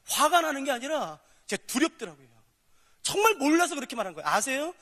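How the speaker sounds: normal talking voice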